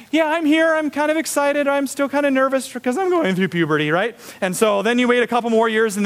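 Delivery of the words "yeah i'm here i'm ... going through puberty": high, awkward preteen voice; pitched-up, half-excited schoolkid; nervous fifth grader. Acted. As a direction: play an awkward kid going through puberty. Acting fifth grader in puberty.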